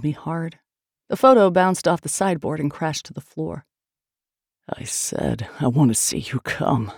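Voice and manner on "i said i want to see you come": low, gasped male demand; gasping. A narrator's reading. Man repeats his demand to his lover, gasping. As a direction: push the repeat out through gasps. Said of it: "he gasped".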